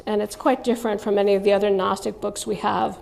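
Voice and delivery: ordinary delivery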